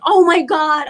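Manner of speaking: high, loud panicked cry; reliving a moment of panic; freaked-out. Retold or imitated. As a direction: exclaim it in sudden alarm, pitch leaping up. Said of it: "And then I freaked out".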